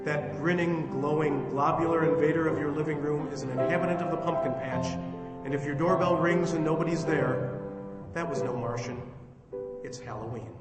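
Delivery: theatrical host's closing address; formal radio sign-off. Acted. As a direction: address the listeners with showman's polish. Host's formal sign-off over music.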